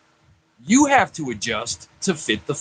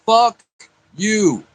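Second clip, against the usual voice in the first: angry; 5 semitones higher, 4 dB louder than usual. Real, not acted. Angry outburst at elders.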